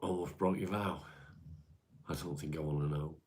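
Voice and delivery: normal talking voice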